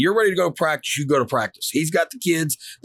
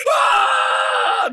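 very high imitated scream; hammed-up high shriek of pain; bloody-murder scream; 4 dB louder than usual. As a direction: scream as if suddenly in agony. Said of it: "Just screams a lot".

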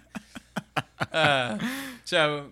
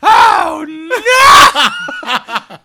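much higher, loud hammed-up scream; mock scream; 17 dB louder than usual. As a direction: scream as she falls. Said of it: "And she goes".

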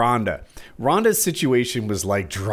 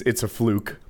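usual speaking voice

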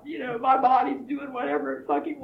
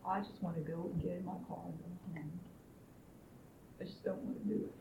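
breathy whisper, words barely voiced; hushed, worn-out whisper; whispered venting; 6 semitones lower, 12 dB quieter, faster than usual. Real, not acted. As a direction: let the anger sink to a whisper. Venting anger, voice drops away.

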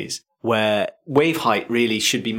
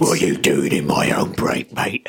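lower, menacing London gangster voice; gangster seal; 7 semitones lower than usual. Pretend. The local seal guarding his break, lower menacing gangster voice. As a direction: play the seal as a hard East End thug. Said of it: "He looks like one of the Krays".

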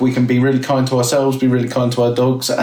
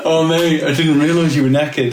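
mock tender apology; 3 semitones higher than usual. Owner apologising to dog.

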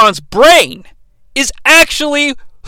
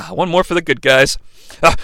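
ordinary talk

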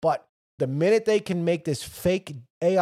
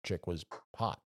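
lower, soft mock despair; mock self-pity; 10 semitones lower, 7 dB quieter than usual. Man fooled by a fake woman, mock despairing mutter. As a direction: groan it out in embarrassed self-pity. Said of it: "you were like".

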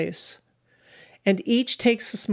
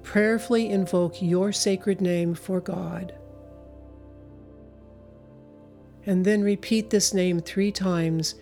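guided meditation. Acted. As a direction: guide them gently, long pauses. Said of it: "take you through a meditation".

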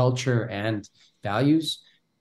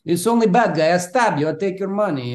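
higher, louder mock Hollywood bad guy; 8 semitones higher, 6 dB louder than usual. Mocking how Western films write Russian villains, hammed-up thug voice. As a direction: ham up the cliché foreign thug. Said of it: "mainstream Western media".

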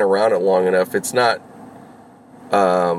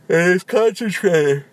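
much higher, slow, yawn-stretched words; talking through a yawn; 10 semitones higher than usual. Real, not acted. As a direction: let a yawn take over the phrase. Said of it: "Oh, excuse me, sorry".

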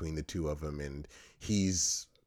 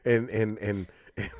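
ordinary talk

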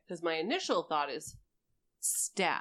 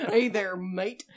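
a light mock Australian accent, louder; 10 dB louder, slower than usual. Player trying a new accent, mock Australian. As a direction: toss off a laughing Aussie greeting. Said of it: "what accent takes its place".

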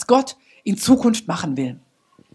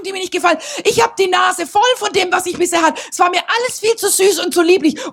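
fervent prayer; 7 semitones higher than usual. Acted. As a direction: cry out to God with full surrender. Prays aloud fervently.

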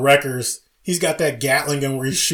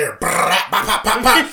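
loud, high gunshot sounds; gunfire noises; 7 semitones higher, 6 dB louder than usual. Retold or imitated. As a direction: rattle off the gunfire pops. Imitating distant gunfire.

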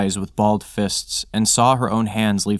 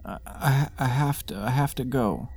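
soft, stammering high murmur; 3 semitones higher, 6 dB quieter than usual. A narrator's reading. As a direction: breathe it out high and halting. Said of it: "she murmured".

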